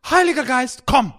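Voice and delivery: loud, high invocation; urgent calling prayer. Retold or imitated. Counsellor out of ideas praying, loud urgent call. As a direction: cry it out as a plea for help. Re-enacts a desperate short prayer.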